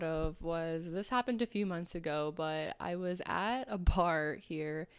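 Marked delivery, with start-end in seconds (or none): none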